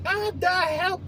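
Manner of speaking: put-on high, giggly little-kid "Ah, da help"; playful toddler cry for help; laughing toddler. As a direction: mimic a small boy laughing as he falls. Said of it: "in a fucking laugh".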